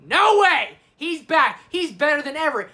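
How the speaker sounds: loud, high, hyped disbelief; amazed reaction. Acted. Viewer seeing Gandalf is back, loudly amazed. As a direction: act out a thrilled fan's outburst.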